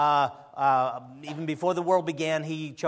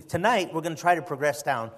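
normal talking voice

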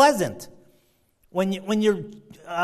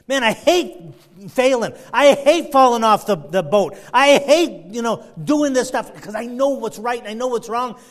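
frustrated; 4 semitones higher, 7 dB louder than usual. Retold or imitated. Voicing the struggling believer.

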